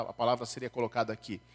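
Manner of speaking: normal talking voice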